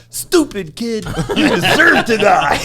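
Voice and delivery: high, loud imitation of a movie heckler; mock yelling. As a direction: yell it as a comic scolding, breaking into laughter. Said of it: "and he's yelling".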